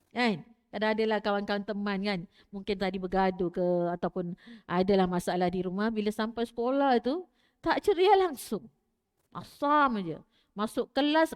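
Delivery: normal talking voice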